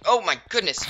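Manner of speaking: high, loud burst of alarm; startled exclamation. Real, not acted. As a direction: blurt it out, caught off guard. Zombies swarm around the house.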